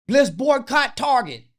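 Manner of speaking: loud, high, slow chant-like shout; mock rallying cry. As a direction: imitate protesters calling out a slogan. Mimics activists' empty rallying call.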